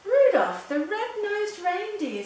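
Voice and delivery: high, loud cry of recognition; delighted exclamation. A narrator's reading. Santa recognising Rudolph, delighted exclamation. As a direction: call the name out big and high.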